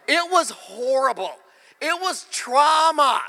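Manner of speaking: high, loud, slow, stretched trauma; mock-dramatic shout. Preacher joking to congregation about school shame, hammed-up shout. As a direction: ham up the horror.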